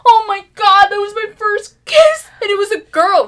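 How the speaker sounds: put-on high, loud, nearly crying kid; mortified second grader. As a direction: wail it like a shocked kid. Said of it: "You're like".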